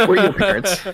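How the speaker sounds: high, giggling through the words; laughing. Retold or imitated. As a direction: laugh the line out. Laughing repeat of quote.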